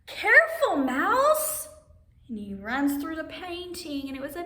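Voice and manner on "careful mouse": high, loud cry of warning; calling out a warning. Acted. Storyteller warning Mouse before the painting, high loud call. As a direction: yell at the mouse to be careful.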